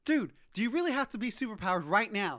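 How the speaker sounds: high, loud scolding of a party guest; mock exasperation. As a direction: act out a fed-up host's plea. Will scolds guest wrecking couch.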